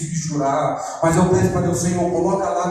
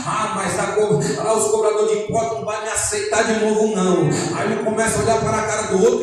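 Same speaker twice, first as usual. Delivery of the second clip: high-pitched, driving sermon delivery; impassioned preaching; 5 semitones higher than usual. Acted. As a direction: preach it with urgent, raised pitch. Preaches at full force.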